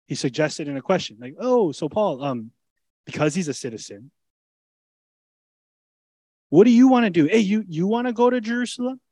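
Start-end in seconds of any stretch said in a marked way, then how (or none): 1.4-9.0 coaxing Festus. Retold or imitated. Festus voiced asking Paul.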